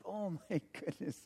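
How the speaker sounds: very soft, stunned retold exclamation; hushed dismay. Driver learning of two warrants, hushed dismay. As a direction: let it out under the breath.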